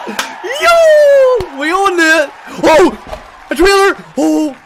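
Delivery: gasping, high and loud hype; ecstatic shouting. Real, not acted. Streamer reacting to a game trailer, ecstatic shouting. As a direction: yell in stunned excitement, words bursting out.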